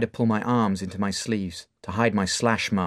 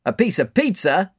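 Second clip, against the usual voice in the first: high, loud incredulous echo; mock his answer; amused bar owner; 10 semitones higher, 6 dB louder than usual. Mark amused by Dave's answer: incredulous echo. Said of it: "Mark laughs".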